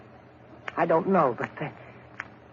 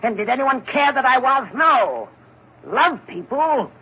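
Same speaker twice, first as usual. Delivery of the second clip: bitter outburst; 6 semitones higher, 6 dB louder than usual. Acted. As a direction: spit out the hatred for people. Oliver's bitter outburst at Simon.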